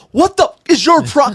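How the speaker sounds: high, loud mock yell at the other driver; retold yelling. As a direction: imitate the angry driver shouting. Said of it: "And just yelling".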